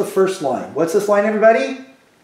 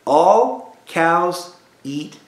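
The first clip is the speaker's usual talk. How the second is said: drawn out word by word, very slow; slow teacher chant; very slow mnemonic; much slower than usual. Shown as a demonstration. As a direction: stretch each word out for the class. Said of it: "You say".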